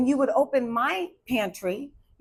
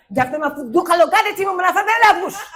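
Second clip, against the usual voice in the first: loud, high Haitian Creole scolding; upset Haitian mother; 8 semitones higher, 10 dB louder than usual. Retold or imitated. Upset mom telling off her daughter Daphne in Creole, loud and angry. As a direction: scold like a furious mom, raised voice. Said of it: "But if she was upset".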